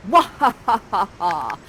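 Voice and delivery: hammed-up villain cackle; theatrical evil laugh. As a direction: cackle like a wicked sorceress. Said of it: "has an evil cackle of a laugh".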